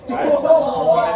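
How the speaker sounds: loud laughing aside. Real, not acted. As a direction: laugh it out, then a high "right?". Laughs at own story.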